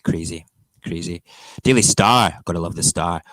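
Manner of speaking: usual speaking voice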